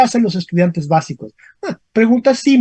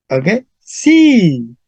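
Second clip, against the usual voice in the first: ordinary talk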